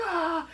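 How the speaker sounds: high-pitched drawn-out mock shriek; mock scream. Husband seeing the maid fall, mock scream.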